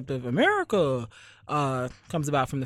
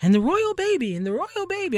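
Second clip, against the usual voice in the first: high, excited mock announcer; mock the hype in a much higher voice; gushing news mimicry; 4 dB louder than usual. Mocking the nightly news about the royal baby, gushing high.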